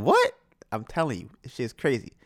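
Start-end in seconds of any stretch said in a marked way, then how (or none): 0.0-0.3 high-pitched incredulous squeak; shocked reaction. Retold or imitated. Viewer reacting to the Spanish trick, in high disbelief. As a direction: mimic a baffled listener, pitch shooting up.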